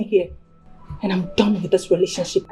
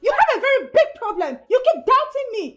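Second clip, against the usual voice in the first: angry outburst; 7 semitones higher than usual. Acted. Girlfriend shouts at doubting boyfriend.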